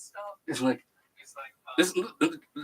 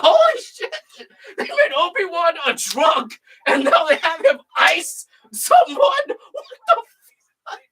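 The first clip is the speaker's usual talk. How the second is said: shocked laughing exclaim; 6 semitones higher, slower than usual. Retold or imitated. Retelling his reaction to drunk Obi-Wan, a high laughing shout. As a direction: yell it, stunned and laughing.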